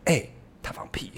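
hushed whisper blaming someone; whispered accusation. Retold or imitated. Girl secretly blaming her fart on a classmate, whispered. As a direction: whisper it sneakily to a neighbour. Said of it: "跟别人讲说".

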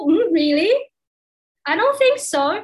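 a light, teasing act of not buying it; mock disbelief. Imagined reply to the teacher, mock sceptical. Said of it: "嘴巴上不能跟老师说".

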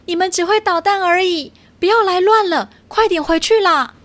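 high, exasperated otter snapping; impatient. Acted. Otter shooing the troublemaking cats, impatient. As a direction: shoo the pests away. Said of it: "海獭不耐烦地说".

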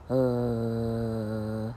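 low, long schwa hum on 呃; 呃 as a drawn-out, lazy schwa; relaxed neutral vowel. Shown as a demonstration. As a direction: let 呃 out with a loose, relaxed mouth. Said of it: "很自然的放松，".

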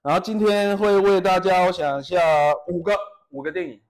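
mock host introducing five films; film critic intro. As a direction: announce like a professional film reviewer. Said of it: "专业影评人".

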